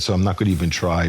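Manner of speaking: ordinary talk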